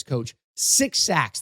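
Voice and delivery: usual speaking voice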